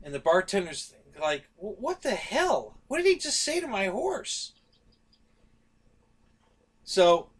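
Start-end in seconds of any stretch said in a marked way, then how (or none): 1.6-4.5 mock bewildered bartender; baffled bartender. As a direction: sound utterly stunned. Bartender baffled by laughing horse.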